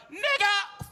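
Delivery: high, loud yelled slur; shout. Retold or imitated. Heckler shouts it a third time, high and loud. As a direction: blurt it out too loud. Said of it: "inside his head voice".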